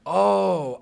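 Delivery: loud, high "oh" of understanding; big retold aha; sudden realization. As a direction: let the penny drop out loud. Reliving the moment it clicked.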